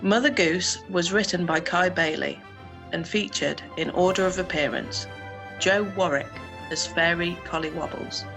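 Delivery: usual speaking voice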